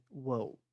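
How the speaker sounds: WORLD with no R, just L and D; British, silent R. Shown as a demonstration. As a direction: swallow the R in WORLD. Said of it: "como esse R". (WORLD sem R, à britânica)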